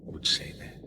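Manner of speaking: breathy whispered aside; a hushed, knowing whisper. Acted. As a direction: lean in and whisper it. Confidential aside.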